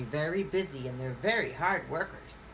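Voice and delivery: normal talking voice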